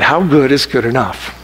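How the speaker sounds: usual speaking voice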